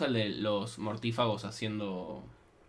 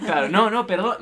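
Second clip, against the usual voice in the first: flustered; 7 semitones higher, 7 dB louder than usual. Acted. Acted candidate flustered, loud high.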